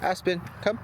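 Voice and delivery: calling the dog in a high sing-song; high-pitched dog call. Real, not acted. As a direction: call her name up high and bright.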